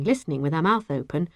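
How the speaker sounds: plain reading voice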